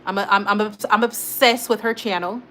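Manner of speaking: ordinary talk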